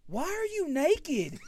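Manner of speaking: high, loud, slow disbelief; baffled yell. Retold or imitated. Husband questioning wife in bed, loud bafflement. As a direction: drag out the shocked question.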